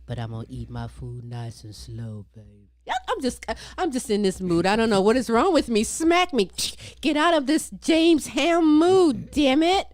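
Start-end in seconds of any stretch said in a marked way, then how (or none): none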